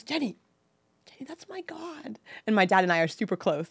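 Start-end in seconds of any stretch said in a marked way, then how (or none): 0.0-2.2 high, hushed, whispery chiding; pleading near-whisper reproach; dad's gentle reproach. Retold or imitated. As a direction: imitate a dad softly scolding his daughter. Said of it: "and he goes".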